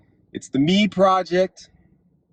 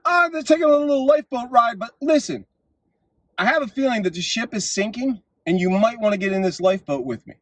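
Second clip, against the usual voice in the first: breezy reply; 7 semitones higher, faster than usual. Retold or imitated. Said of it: "People are like".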